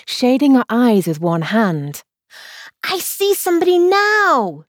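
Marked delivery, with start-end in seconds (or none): 2.8-4.6 high, eager exclamation; Alice exclaiming, pitched high. A narrator's reading. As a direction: call it out as she spots him. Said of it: "she exclaimed at last".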